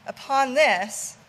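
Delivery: plain reading voice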